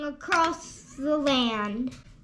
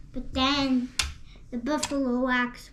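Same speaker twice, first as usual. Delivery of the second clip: plain reading voice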